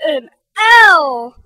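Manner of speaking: wail, near tears, and break off. Acted. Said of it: "wailed Fragility".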